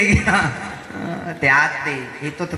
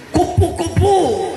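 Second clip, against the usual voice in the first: much higher and slow, cooing the word; sweet cooing exclamation; 12 semitones higher, 3 dB louder, much slower than usual. Pretend. The preacher swaps a curse for a sweet word, cooing high and slow. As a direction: coo it like admiring something pretty. Says pretty animal instead of cursing.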